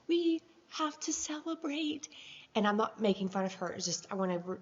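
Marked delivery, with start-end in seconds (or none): 0.1-2.1 high-pitched imitation of a cheerful neighbor; imitating Joanne. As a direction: mimic the neighbor's insistent glee. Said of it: "get across how Joanne was".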